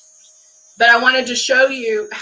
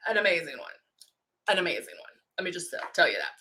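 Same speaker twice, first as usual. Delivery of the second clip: much softer and lower, an echoing aside; wistful aside; 7 semitones lower, 13 dB quieter, much faster than usual. Shown as a demonstration. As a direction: murmur it to yourself. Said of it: "I had an amazing one".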